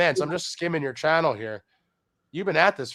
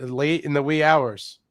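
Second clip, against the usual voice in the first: ordinary talk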